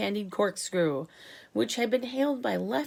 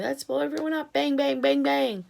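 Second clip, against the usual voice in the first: higher, louder gleeful bangs; army chief eager to blow everyone up, higher and louder; 6 semitones higher, 5 dB louder than usual. A narrator's reading. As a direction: relish every bang.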